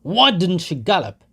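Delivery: loud, high mocking cry; high, loud taunting shout; jeering shout. A narrator's reading. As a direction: yell it at the dead horse. Crowd member jeering.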